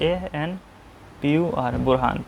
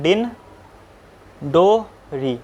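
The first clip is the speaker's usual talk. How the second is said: Dindori stretched out very slowly for the class; slowly drawn out; much slower than usual. Shown as a demonstration. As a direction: draw out each syllable of Dindori like a teacher. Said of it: "क्या नाम है?".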